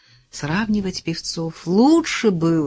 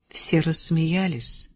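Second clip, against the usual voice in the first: ordinary delivery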